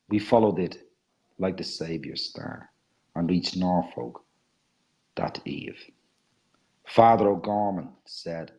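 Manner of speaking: low, measured storytelling verse with long pauses; dramatic poem reading. Acted. Reading the Lady Tempest poem to an audience, dramatic poem reading.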